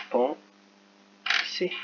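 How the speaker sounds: penser split slowly into pen... ser; syllable by syllable. Shown as a demonstration. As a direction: sound out penser slowly while writing it. Sounding out the word while writing.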